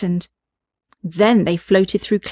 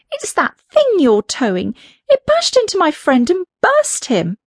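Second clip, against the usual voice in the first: high, upset little balloon; a little creature, shaken; small balloon voice; 8 semitones higher than usual. Acted. Balloon speaks.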